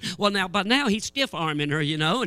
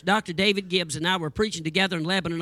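usual speaking voice

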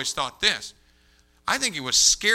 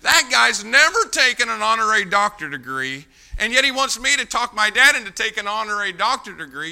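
loud, raised-pitch preaching indignation; incredulous outburst; 6 semitones higher, 9 dB louder than usual. Real, not acted. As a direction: belt it out to the congregation in disbelief.

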